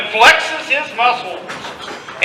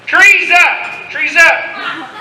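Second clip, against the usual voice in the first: higher-pitched called-out cue; calling a cue; 6 semitones higher, much slower than usual. Real, not acted. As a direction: call it out to the players. Director cues the audience trees.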